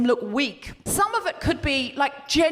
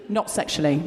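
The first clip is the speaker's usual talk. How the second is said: low, parenthetical afterthought; bracketed aside; 11 semitones lower than usual. Shown as a demonstration. Host qualifying the slogan, low bracketed aside. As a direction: drop it down as if in brackets. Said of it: "sort of more bracketsy".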